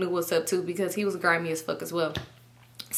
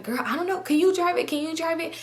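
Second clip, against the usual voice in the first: high, soft mock-clueless voice; acting dumb; 8 semitones higher, 3 dB quieter than usual. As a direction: imitate her playing helpless at the wheel. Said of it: "She acting dumb as fuck".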